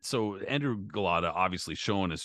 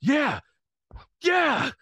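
much higher, much louder hype yell; imitating trainer's shout; 10 dB louder than usual. Trainer yelling to pump up his boxer. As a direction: pump him up, bellow it. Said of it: "Emmanuel Steward starts yelling at him".

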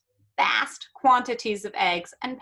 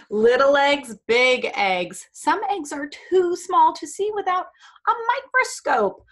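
dramatic read-aloud; 5 semitones higher, 7 dB louder than usual. Acted.

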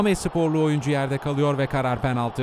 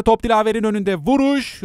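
excited commentator; 6 semitones higher, 6 dB louder than usual. Real, not acted. Striker shoots, commentator rises.

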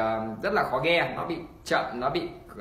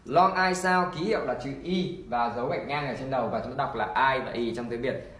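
usual speaking voice

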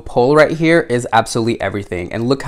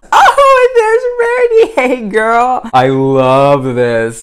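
excited squeal; 10 semitones higher than usual. Real, not acted. Spots Rarity on the box.

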